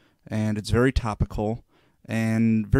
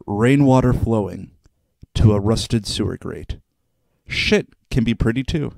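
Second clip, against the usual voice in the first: poem recital; 3 dB louder than usual. Shown as a demonstration. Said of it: "So this poem is entitled".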